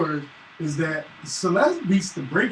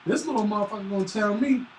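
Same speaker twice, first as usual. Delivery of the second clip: ordinary talk, nothing marked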